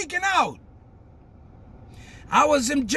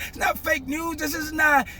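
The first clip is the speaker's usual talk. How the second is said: ordinary talk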